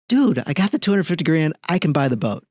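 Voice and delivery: acted-out defensive exasperation; exasperated protest. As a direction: push back at a doubting friend, pitch jumping up. Boat buyer protests the friend's questions.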